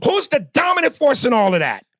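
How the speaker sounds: high, heated demanding question; real impatient challenge; angry demand. Real, not acted. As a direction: push the question through, exasperated. Repeats demand over interruption.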